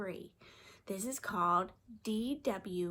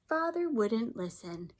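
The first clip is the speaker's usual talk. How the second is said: ordinary delivery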